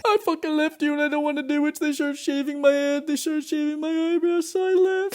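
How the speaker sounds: very high, fast mock whine; panicking wife. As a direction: squeal out a hammed-up tearful confession. Wife's panicked call, acted.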